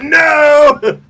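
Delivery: high, loud desperate no; straining shout. Retold or imitated. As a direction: yell it as the chest slips. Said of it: "and you're like".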